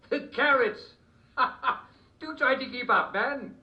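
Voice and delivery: high, loud royal huff; hammed-up impatient king; exasperated mad king.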